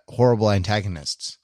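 ordinary talk

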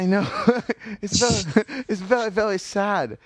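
high, laughing mock lament. Real, not acted. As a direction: laugh while calling it sad. Laughing over sad poem.

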